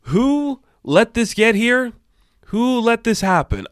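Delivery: high, slow mock outrage; drawn-out high incredulous whine; exasperated disbelief. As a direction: wail who let this happen. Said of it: "where I'm like".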